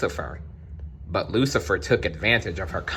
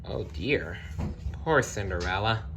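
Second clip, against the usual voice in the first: softer, pitying aside; sympathetic sigh; 7 dB quieter than usual. A narrator's reading. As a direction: feel sorry for her, softly.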